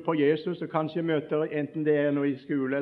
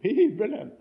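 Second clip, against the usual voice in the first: mocking laugh; 8 semitones higher, 4 dB louder than usual. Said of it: "Og så roper han ut, og så ler hele bussen".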